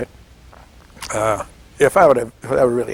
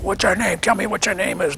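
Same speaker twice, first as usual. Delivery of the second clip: higher, imitating a captor demanding answers; mock interrogator; 8 semitones higher than usual. Captor interrogating the tied-up soldier, mock demanding. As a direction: play the captor pressing a tied-up prisoner.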